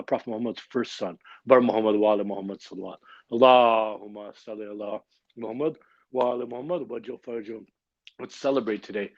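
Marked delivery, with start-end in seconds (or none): 1.5-7.6 quick chanted call to send blessings; salawat call. Acted. Host calls the gathering to send salawat, chanting. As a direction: rattle off the salawat like a prayer leader.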